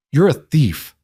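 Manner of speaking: plain reading voice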